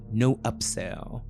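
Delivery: plain reading voice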